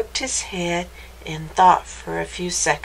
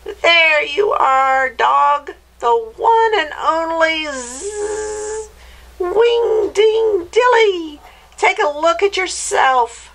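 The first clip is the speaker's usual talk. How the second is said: gleeful witch; 8 semitones higher, 4 dB louder than usual. Acted. Witch showing the dog what he became, gleeful. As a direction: present the creation with screechy glee.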